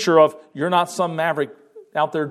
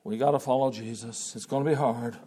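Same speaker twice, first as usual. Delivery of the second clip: glum believer; 4 semitones lower than usual. Pretend. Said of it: "It's not".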